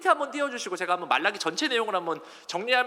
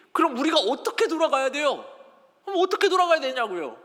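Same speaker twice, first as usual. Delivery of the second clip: higher-pitched retold protest; mock indignant questioning; 6 semitones higher, 4 dB louder than usual. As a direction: ask back as if put upon. Retells Israelites arguing back at God. (따지듯 높여 되묻기)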